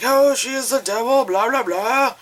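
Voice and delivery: high, theatrical doom-laden declaiming; James Mason. Acted. Reviewer imitating cult members warning of the devil, grand and high. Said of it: "I kinda sound like James Mason there".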